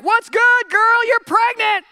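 high, loud, breezy angel announcing the news; hyped angel. Retold or imitated. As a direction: play a casual, pumped-up messenger. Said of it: "an angel of the Lord was like".

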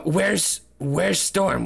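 ordinary talk

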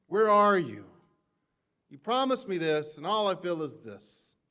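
hammed-up accusing plea; mock complaint to God. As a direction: play the let-down believer calling out. Voicing a believer's complaint to God.

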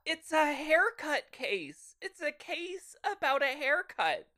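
exasperated and high-pitched; incredulous. Real, not acted. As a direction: stress how petty it is. Incredulous correction.

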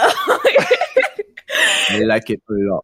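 laughing quote. Acted. Echoing movie quote laughing.

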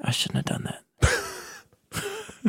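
very high, soft, slow guilty little voice; hushed, high mock regret; regretful David. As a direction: play David wincing at what he did. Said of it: "He's like".

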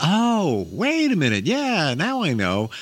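high, drawn-out mock "aha"; sudden realization. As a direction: imitate someone finally connecting the dots. Imitates client's sudden realization.

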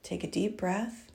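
soft spa whisper. Acted. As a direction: guide them gently into the breath. Leads a breathing exercise.

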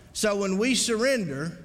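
ordinary delivery

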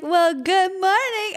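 high, slow cheery greeting; impression of Sarah. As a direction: stretch it sweetly like Sarah. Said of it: "basically be me and take an order".

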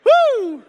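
sudden high "woo" of release; big, piercing hoot; high loud whoop. Real, not acted. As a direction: shake off the scare with a whoop. Whoop after scaring the room.

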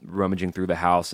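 normal talking voice

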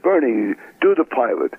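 imitating an aggressive agent; pushy agent. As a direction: push him to perform, voice lifted. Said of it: "had a very aggressive agent".

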